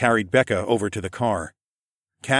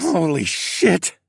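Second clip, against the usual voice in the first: higher, slow croaked exclamation; croak of relief; 7 semitones higher, much slower than usual. A narrator's reading. Narrator hears Becca alive, stunned croak. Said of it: "I croaked".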